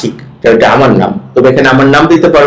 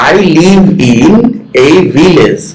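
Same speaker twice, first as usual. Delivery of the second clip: slow model reading; much slower than usual. Shown as a demonstration. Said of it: "with proper stress".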